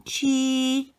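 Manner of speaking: 七 held high and level, first tone. Shown as a demonstration. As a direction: keep 七 high and flat. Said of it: "it's accent number one".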